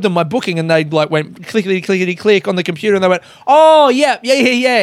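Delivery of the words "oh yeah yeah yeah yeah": eager travel agent. Retold or imitated. Imitating travel agent finding flight.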